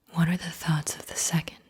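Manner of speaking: ordinary talk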